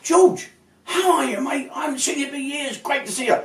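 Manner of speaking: put-on very high, delighted hail to an old mate; mock-gushing reunion cry, voice way up; delighted greeting. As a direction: greet a long-lost pal, high-pitched. Greets long-lost friend in retold meeting.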